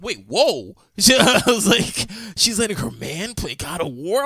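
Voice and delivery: high, loud stunned whoa breaking into a laugh; amazed disbelief. Retold or imitated. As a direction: act floored by what you see, then laugh. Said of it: "And I was like".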